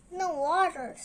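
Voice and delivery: short, loud snap; young reader giving a mild, loud snap; snapped. A narrator's reading. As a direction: snap the words back, irritated.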